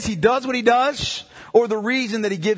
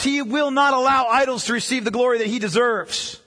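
usual speaking voice

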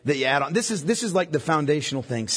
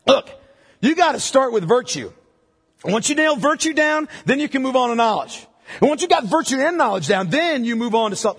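higher and louder, like a strict checklist teacher; mock stern instruction; 7 semitones higher, 4 dB louder than usual. Preacher mocking a step-by-step view to the congregation, loud and raised. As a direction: bark out the steps as a rigid rule-giver. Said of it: "Peter's not saying".

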